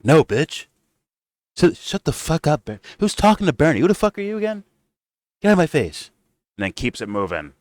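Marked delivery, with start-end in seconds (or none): 0.0-6.1 high, dismissive sneer, rushed in the middle; mock Trump. Trump brushing off Bernie, in a high sneering mock voice. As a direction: brush him off with contempt. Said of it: "Trump's like".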